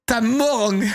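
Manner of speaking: badly faked southern twang on "morgue"; botched Marseille accent. Streamer mocking own line, botched Marseille accent. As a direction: overdo the Marseille nasal ending. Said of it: "c'est vraiment pas ça, l'accent".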